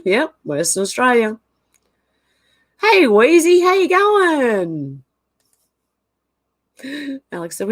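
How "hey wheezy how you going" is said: high, drawn-out cheery hello; sing-song greeting. Real, not acted. Greeting a viewer in live chat.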